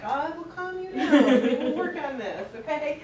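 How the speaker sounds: mock soothing, laughing. Trainer imitating the calm supervisor, laughing mock soothing. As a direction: play the unflappable boss while laughing. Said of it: "she's like".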